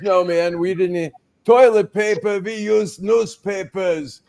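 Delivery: put-on high, slow old man's voice, pushed loud at the start; a frail old man out-suffering the other guy; old Holocaust survivor. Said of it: "And he goes".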